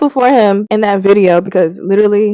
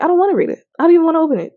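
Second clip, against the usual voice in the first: higher, softer pouting whine; whiny refusal; 6 semitones higher, 4 dB quieter, much faster than usual. Retold or imitated. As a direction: pout it out. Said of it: "I was like".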